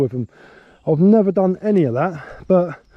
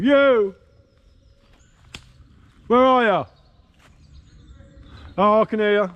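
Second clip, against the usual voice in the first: calling out; 6 semitones higher than usual. Real, not acted. Calling out to lost stepson.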